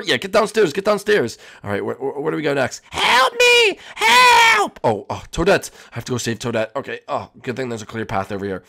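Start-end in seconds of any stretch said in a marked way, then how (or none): 2.9-4.8 piercing high scream for help; trapped and screaming from afar; high shout for help. Acted. Toadette trapped, calling.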